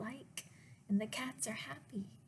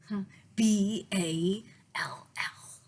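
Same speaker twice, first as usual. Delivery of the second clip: slow, emphatic spelling; spelled out; 9 dB louder, slower than usual. Acted. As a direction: teach each letter. Nick spells for cats.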